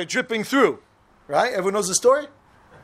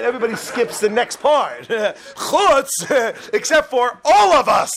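laughing; 6 semitones higher, 3 dB louder, slower than usual. Real, not acted. Punchline about exceptions, laughing.

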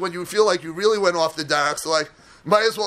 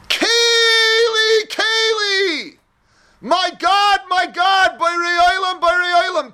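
much higher and louder, pleading cry; crying out to God; 9 semitones higher, 4 dB louder, slower than usual. Acted. Esther crying out to her God, desperate loud calls. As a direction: call out to God as if abandoned.

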